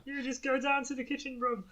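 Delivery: teasing Brummy accent impression; mock Brummy accent. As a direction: parody the line in a Brummy accent. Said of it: "What are they Brummy?".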